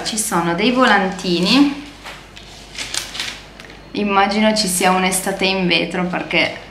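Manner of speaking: ordinary delivery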